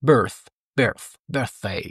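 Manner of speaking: birthday with tongue between teeth for th; teacher drilling the th sound. Shown as a demonstration.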